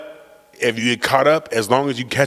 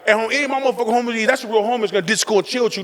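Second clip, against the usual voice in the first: fast, high imitation of an excited dad; quick high hyped dad voice; 10 semitones higher, faster than usual. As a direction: rattle off dad's praise, pitched up. Dad voiced praising homies.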